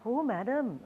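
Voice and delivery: put-on lightly done Hindi accent; mild Hindi accent imitation. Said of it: "my attempt at a Hindi accent".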